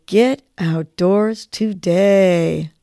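very slow, drawn out on the breath; slow on the exhale. Shown as a demonstration. Host repeats the nudge with listeners, slow exhale. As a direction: breathe the words out slowly.

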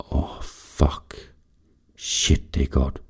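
whispered, breathless pleasure; a whispered gasp of pleasure; aroused whisper. A narrator's reading. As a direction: whisper it, overcome. Narrator overwhelmed with pleasure.

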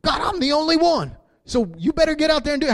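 complaining prophet. Retold or imitated. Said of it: "he was saying".

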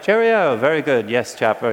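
a light mock posh British accent. Crowd cheering in mock British accent, lightly done. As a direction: play a hammed-up Victorian Brit. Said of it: "It's probably like".

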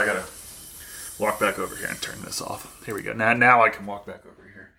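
ordinary talk, nothing marked

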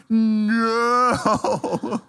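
long drawn-out, high no; mock horror, stretched in slow motion; slow-motion coach's no. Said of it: "picture my coach going".

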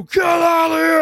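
very high mock screech; freaking-out scream. Mover ordering the couple away, high frantic scream. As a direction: scream them off in a high pitch. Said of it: "I'm freaking out".

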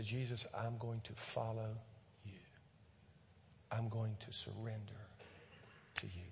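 soft, low voiced prayer; hushed words of surrender; quiet prayer. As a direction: pray it gently for them.

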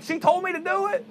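high, whining excuse; Adam whining his excuse to God; whiny Adam. Acted. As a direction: pass the blame like a caught kid. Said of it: "He's like".

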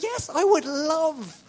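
loud, high, drawn-out enthusiasm; emphatic, wholehearted eagerness; eager yes. Retold or imitated. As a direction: burst out eagerly, stretching the words. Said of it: "I'm like".

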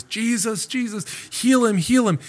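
high, pleading cry; begging woman. Retold or imitated. Canaanite woman begging Jesus to heal, high plea. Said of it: "she came begging Jesus".

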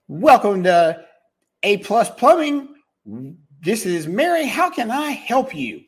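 high, bright phone greeting; cheerful receptionist greeting. Acted. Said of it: "which is how she should answer".

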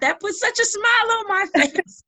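high, loud laugh breaking through the words; laughing delight. Real, not acted. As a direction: laugh with real joy at the end. Moved by letters, laughing.

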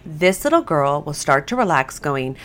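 normal talking voice

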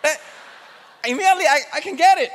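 usual speaking voice

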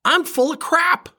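mock disgust, dragged out; self-scolding. Scolding himself for his self-pity, high slow scoff.

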